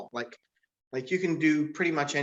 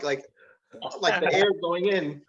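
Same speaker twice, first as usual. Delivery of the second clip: ordinary delivery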